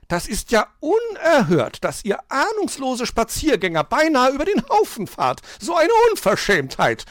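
high, loud indignant outburst; beside herself scolding. A narrator's reading. Magpie scolds children who nearly hit her, outraged. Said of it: "schimpfte die Elster außer sich".

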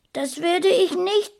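put-on high, loud little boy's protest; defiant child. A narrator's reading. Small boy refusing his mother's order, defiant.

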